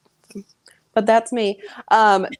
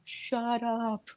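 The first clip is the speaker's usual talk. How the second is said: mock muttered; 4 semitones higher, 10 dB quieter than usual. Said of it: "even the guests are like".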